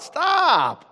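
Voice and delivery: a high, loud shouted stop; sudden command. Acted. God halting us before the cliff, shouted stop. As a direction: yell it like pulling someone back.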